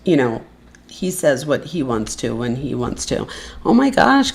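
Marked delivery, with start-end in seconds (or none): 3.6-4.3 higher-pitched happy exclamation; real burst of grateful surprise; delighted surprise. Real, not acted. Reacting live to a supporter's gift.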